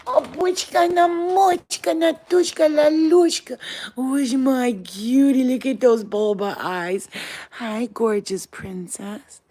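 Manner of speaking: high, slow pet-talk cooing. Real, not acted. Doting owner greeting the dog, gushing baby talk. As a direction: gush nonsense nicknames at an adored pet.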